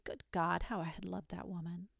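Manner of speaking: a broken lover whispering his regret; grieving whisper. A narrator's reading. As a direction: let it fall to a whisper of grief. Grief confession trails away.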